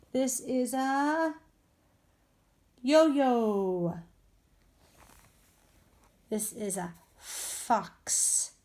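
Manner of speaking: slow, drawn-out model reading; slow exaggerated speech modeling. Shown as a demonstration. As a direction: stretch each word for young readers. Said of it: "modeling words for children".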